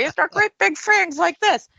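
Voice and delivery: mock her like the people who tease her; mocking imitation. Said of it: "making fang faces at me".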